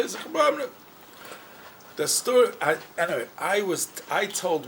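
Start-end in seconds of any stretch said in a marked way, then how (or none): 0.2-0.7 imitated. Visitor answer, imitated.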